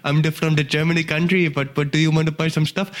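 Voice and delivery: mock salesman with an Indian accent. Shown as a demonstration. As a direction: put on a comic Indian accent. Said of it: "I could do, like, an Indian accent".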